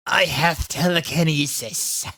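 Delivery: slow, mock cruel villain line; answered cruelly. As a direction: drawl it out like a smug villain. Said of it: "he answered cruelly".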